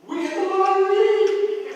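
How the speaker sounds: hammed-up squeal of joy; mock excited wife. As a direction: shout the news like a lottery win. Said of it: "and she goes".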